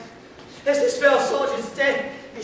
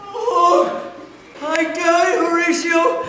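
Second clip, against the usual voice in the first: anguished death cry; 5 semitones higher, 6 dB louder, much slower than usual. Acted. Hamlet cries out as he dies.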